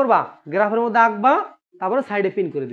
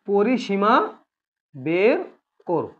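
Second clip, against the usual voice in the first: spaced out with a pause after each word; deliberate, broken-up repetition; 3 semitones lower, much slower than usual. Shown as a demonstration. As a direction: dictate it piece by piece to the class. Repeats the exam instruction word by word.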